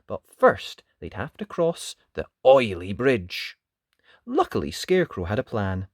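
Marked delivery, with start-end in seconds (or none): none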